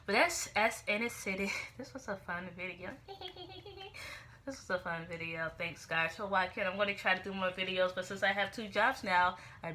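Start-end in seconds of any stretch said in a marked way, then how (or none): 3.0-4.2 high, soft string of giggles. Real, not acted. Vlogger amused by her own video, soft high giggle. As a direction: giggle lightly, high and quick.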